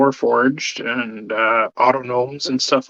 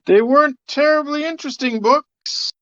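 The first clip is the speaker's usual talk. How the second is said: high, hooty ghost voice; hammed-up high spook; lame ghost; 12 semitones higher than usual. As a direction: answer back as a sulky phantom. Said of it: "You sound like a really lame ghost".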